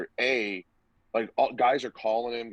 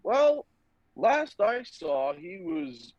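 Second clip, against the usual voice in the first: squeaky nervous voice; 13 semitones higher, much slower than usual. Retold or imitated. Nervously explaining to the coach.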